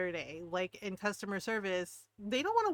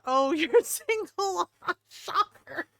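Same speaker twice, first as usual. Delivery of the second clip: higher, louder, slow, breaking into laughs; laughing retelling; 6 semitones higher, 5 dB louder, much slower than usual. Retold or imitated. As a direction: laugh through the quoted punchline. Retelling her joke that amused him.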